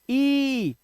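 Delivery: big ई, held long; sustained long ई vowel. Shown as a demonstration. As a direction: stretch ई out steadily. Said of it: "बड़ी ई".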